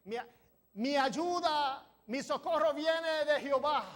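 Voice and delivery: ordinary talk, nothing marked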